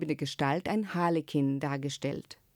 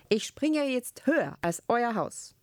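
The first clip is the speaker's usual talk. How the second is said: raised-pitch, emphatic announcement; mild shout; 6 semitones higher than usual. A narrator's reading. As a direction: proclaim it in a high voice without full volume. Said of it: "schrie der Magister". (mit erhobener Stimme leicht gerufen)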